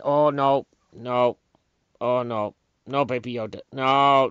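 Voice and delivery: loud, pained cries of no; distressed oh no. Real, not acted. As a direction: protest as if watching it happen. Upset recalling Baby Yoda scene.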